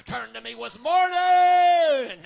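very loud, slow, drawn-out shout; climactic shout. Acted. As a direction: hold "mourning" in a huge shout.

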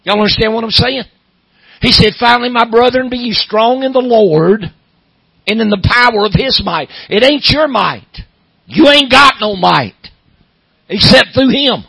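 loud, high, hammering revival preaching; fiery Southern pulpit sermon; preaching at full force. Acted. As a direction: preach it hard to the congregation, building.

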